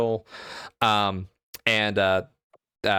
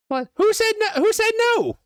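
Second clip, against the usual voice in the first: very high, loud squeaky demand; angry orc; 5 dB louder than usual. Acted. Orc guard demanding who spoke, high loud squeaky voice. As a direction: orc snapping at the group.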